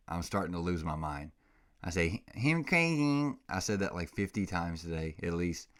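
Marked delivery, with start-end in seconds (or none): 2.3-3.3 put-on high, slow cooing baby talk; doggy baby talk. Owner to his puppy, in high cooing baby talk. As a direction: coo it like talking to a puppy. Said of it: "the stupidest stuff I say all day to this dog".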